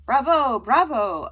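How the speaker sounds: very loud, booming acclaim; cheering bravo. A narrator's reading. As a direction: applaud the actors out loud. Teacher applauding the young actors.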